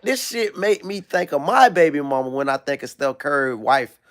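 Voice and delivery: normal talking voice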